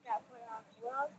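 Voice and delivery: usual speaking voice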